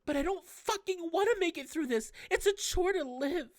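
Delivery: put-on high girl's voice, bitter and rising; Tsitsi lashing out. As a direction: snap back at him as the hurting girl. Tsitsi despairing.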